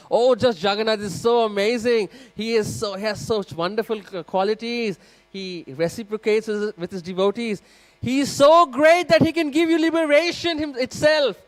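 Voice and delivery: high, loud, gushing praise peaking in a near-shout; bursting with first-day enthusiasm, selling every word; fired-up preaching. Acted. Eager new priest preaching to a young couple, loud and gushing high. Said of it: "he's super fired up".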